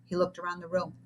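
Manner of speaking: plain reading voice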